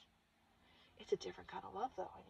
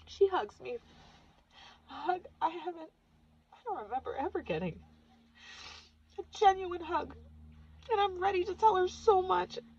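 much higher, tearful-sounding; choked up; 11 semitones higher than usual. Real, not acted. Daughter recounting hug from her late mother, high and choked up. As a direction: voice rising with emotion. Emotional vision of hugging mother.

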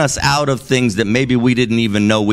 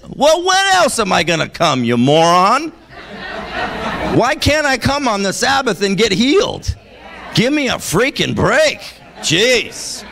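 exasperated retort; 9 semitones higher than usual. Retold or imitated.

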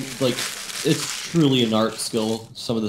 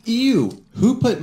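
disgusted ew; 9 semitones higher, 4 dB louder, slower than usual. Real, not acted. Reacting to tasting marzipan.

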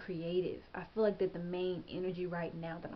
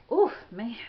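ordinary talk, nothing marked

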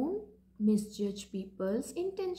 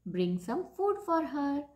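ordinary delivery, nothing marked

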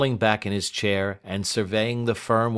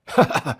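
sudden loud, higher laugh; short laugh; 8 semitones higher, 8 dB louder than usual. A narrator's reading. Client breaking into a laugh at his lawyer, amused. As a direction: break into a brief acted laugh. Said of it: "which presently broke into a laugh".

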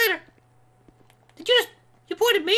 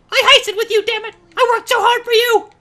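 loud, indignant rant; mock outrage; 3 semitones higher, 7 dB louder than usual. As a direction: berate an ungrateful partner loudly. Acted quarrel over eviction.

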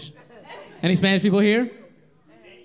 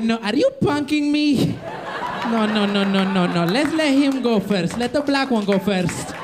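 put-on a light Spanish accent, higher and slow; Mister Ramirez in accent; 4 semitones higher, slower than usual. Mister Ramirez declining to run for president, light Spanish accent, slow. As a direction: hang back nervously in a mild Spanish accent. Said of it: "He's like".

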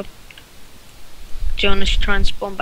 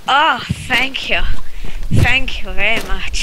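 loud put-on grateful voice; mock thanks; 3 semitones higher, 7 dB louder, slower than usual. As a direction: gush thanks in a silly voice. Mock reply to players.

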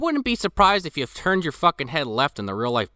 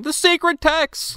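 high, drawn-out mock scream; Mark Hamill; 3 dB louder, much slower than usual. Imitating an actor screaming as the tree burns, high wail. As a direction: wail it slowly, pitched way up. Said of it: "you just hear Mark Hamill screaming".

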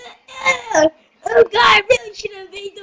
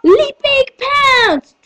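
high, drawn-out battle call; attack cry; 9 semitones higher, slower than usual. Acted. Fighter launching a leaping pounce, high drawn-out cry. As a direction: call the move out high and long.

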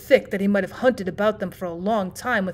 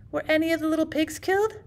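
put-on anxious child; 7 semitones higher, 3 dB quieter than usual. Child asks about the pigs.

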